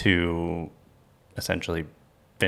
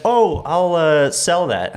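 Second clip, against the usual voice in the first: high, louder mock realization; retold bright idea; 10 semitones higher, 6 dB louder, slower than usual. Host retells his thought about selling hoarded stuff, high-pitched mock realization. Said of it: "And then".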